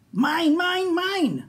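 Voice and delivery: high, loud greedy dino roar; roaring Minosaur. Acted. Minosaur roars at the playing dinosaurs, high and loud. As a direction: claim everything with a shrill roar.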